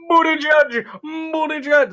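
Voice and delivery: "Buttigieg" as boo-tee-judge, high-pitched; high, comic "Buttigieg", boo-tee-judge; own silly pronunciation. Shown as a demonstration. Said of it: "That's how I say it anyway".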